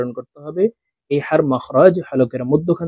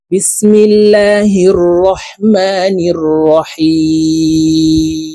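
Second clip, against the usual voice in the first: Quranic recitation; 3 semitones higher, 7 dB louder, much slower than usual. Shown as a demonstration. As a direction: intone it like tilawat before class. Teacher opens with recitation.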